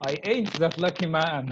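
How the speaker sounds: amused, laughing through the words. Real, not acted. Teacher jokes back to interviewer about luck, laughing. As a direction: say it through a chuckle. Joking reply between two laughs.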